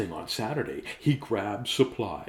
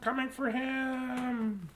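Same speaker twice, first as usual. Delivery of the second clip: high, slow, eerie drawn-out line; eerie echo; 4 dB quieter, much slower than usual. Acted. Reader echoing the threat in an eerie voice. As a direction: stretch it out like a ghost. Spooky echo in read-aloud.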